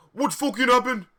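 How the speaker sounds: high, loud hammed-up yell; over-the-top outraged yell; mock shout. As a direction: shout it like an outraged goth boy. Draco voiced shouting.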